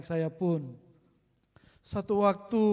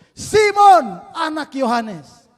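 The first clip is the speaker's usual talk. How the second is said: very loud high call, then slow; Jesus calling; 7 semitones higher, 13 dB louder than usual. Acted. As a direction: call out to Simon across the shore. Jesus asks second time.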